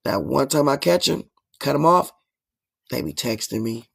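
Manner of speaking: ordinary talk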